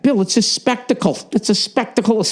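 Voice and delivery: high, mocking impression of a friend hyping it up; mocking Verzi. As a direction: parrot him in a high, silly voice. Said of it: "Verzi was saying that".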